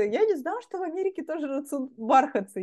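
mock innocent surprise; imitating Sonya. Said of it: "и она говорит". (наивно-удивлённо)

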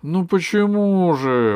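high, loud, stretched question; genuinely puzzled, drawing out the words; surprised. Acted. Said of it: "удивилась Алиса". (протяжное удивление)

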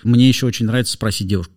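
ordinary delivery

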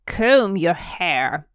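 loud, slow bark; barked order. Acted. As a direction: bark the command at the boy. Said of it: "he barked".

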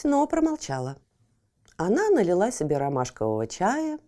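ordinary delivery, nothing marked

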